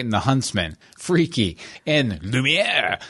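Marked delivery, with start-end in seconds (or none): 1.9-3.0 slow, drawn-out French Lumiere; mock French pronunciation of "Lumiere"; French accent. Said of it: "I have to say it every time like that".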